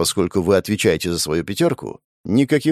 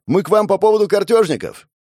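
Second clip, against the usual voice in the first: higher, loud shout; 9 semitones higher, 4 dB louder than usual. Acted. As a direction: call it out to a deaf old woman. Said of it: "снова прокричал Костенко".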